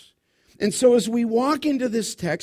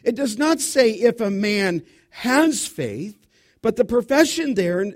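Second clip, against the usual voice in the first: ordinary delivery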